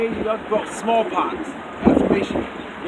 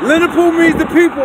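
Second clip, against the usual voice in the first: louder; 7 semitones higher, 7 dB louder than usual. Real, not acted. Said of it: "I'm talking too low".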